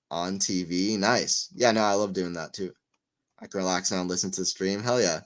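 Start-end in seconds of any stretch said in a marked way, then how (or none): none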